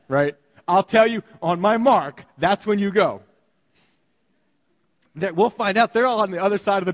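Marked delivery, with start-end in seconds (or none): 0.7-3.2 playful command. Retold or imitated. Jesus instructs fish, playful.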